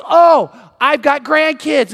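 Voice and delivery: high, loud, stretched-out enthusiasm; light up proudly, drawing the words out; imitating an excited grandparent; delighted boss. Delighted CEO reacting in retold chat.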